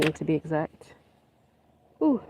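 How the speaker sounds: usual speaking voice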